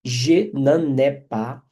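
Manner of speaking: "Je n'en ai pas" very slow, "n'en" linked nasal into "ai"; careful pronunciation. Shown as a demonstration. Teacher models the liaison for students, very slow. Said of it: "A pronúncia dá mais trabalho".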